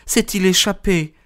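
high-pitched woman's character voice; narrated anxious female exclamation; anxious cry. A narrator's reading. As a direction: cry out high, without added volume. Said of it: "s'écria-t-elle".

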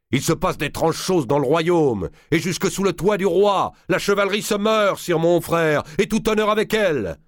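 high, loud ranting uncle; indignant tirade. A narrator's reading. Valois rails at his brother the king, a high indignant tirade. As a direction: rail at the court's decay. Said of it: "pour exploser".

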